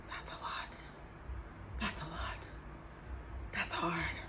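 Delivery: soft, near-whispered comfort; hushed sympathy. Retold or imitated. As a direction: say it gently, barely above a breath. Friend quietly comforting the speaker.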